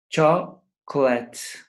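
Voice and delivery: Chocolate said slowly in two syllables, CHOC-let; slow model word. Shown as a demonstration. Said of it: "Two syllables".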